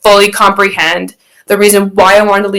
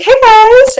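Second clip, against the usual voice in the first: fake cheery greeting; 3 dB louder than usual. Faking arrival from youth group.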